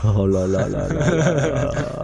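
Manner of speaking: slow sing-song "là là là"; drawn-out dismay. Real, not acted. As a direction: stretch it out in disbelief. Dismay after the round.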